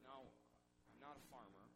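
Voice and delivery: usual speaking voice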